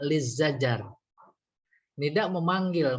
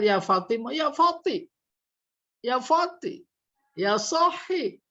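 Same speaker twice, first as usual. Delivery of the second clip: high-pitched, cut-off calls for help; acted drowning cry, much higher; drowning person calling; 10 semitones higher than usual. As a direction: call out as if going under. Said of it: "Murokhom itu seperti orang kelelep".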